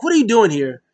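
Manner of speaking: high, loud mock tantrum; imitated indignant outburst; hissy fit. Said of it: "Sammy has a hissy fit".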